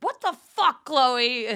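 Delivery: high, loud mock scolding; outraged teacher. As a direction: yell like an exasperated teacher at a disruptive student. Teacher scolds her for heckling.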